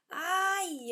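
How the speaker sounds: high yelp of pain; boy crying out after kicking the table; ouch cry. Acted. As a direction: yelp as he hurts his foot. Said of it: "ele gritou".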